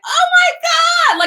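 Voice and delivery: mock panicked cry, loud, slow and high; hammed-up high, loud panic; panicked New Yorker. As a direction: wail it high and drawn out, imitating New York panic. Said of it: "everybody's in this sense of panic".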